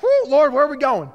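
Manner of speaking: high, loud mock panic; comic alarmed shout heavenward; panicked cry to God. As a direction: cry out like you're lost in traffic. Panic at Kingston roundabout, crying out.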